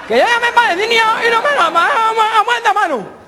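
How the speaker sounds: high, frantic garbled voice; garbled caller. Acted. Caller reporting to a man who understands nothing, garbled. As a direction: babble urgently in a high voice. Same caller tries again.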